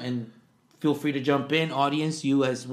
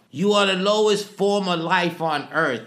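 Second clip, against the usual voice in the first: louder, slow military dressing-down; loud, deliberate sergeant voice; drill sergeant quote; 5 semitones higher, 6 dB louder than usual. Acted. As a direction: bark it slowly like a drill sergeant. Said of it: "I don't know what accent I'm gonna do".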